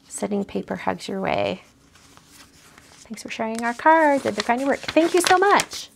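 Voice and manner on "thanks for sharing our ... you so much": bright, much higher sing-song thanks; sing-song card voice. Acted. Reading the card's thanks to viewers, high and sing-song.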